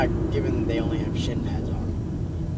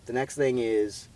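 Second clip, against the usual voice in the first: ordinary talk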